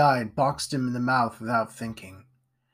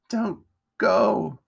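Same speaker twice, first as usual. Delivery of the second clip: high, halting, drawn-out plea; pained pleading; 7 semitones higher than usual. A narrator's reading. Wounded Edgar begs Jack to stay, pained. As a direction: beg with a break between the words.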